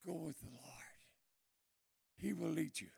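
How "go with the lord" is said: "Go with the Lord" whispered, soft and low. Shown as a demonstration.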